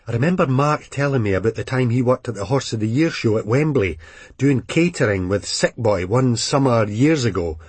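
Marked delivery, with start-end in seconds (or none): none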